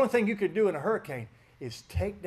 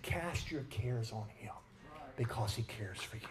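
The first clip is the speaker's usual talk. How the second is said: soft whisper; 8 semitones lower, 14 dB quieter than usual. Acted. Preacher whispering the verse to the church. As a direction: drop to a lower, hushed whisper.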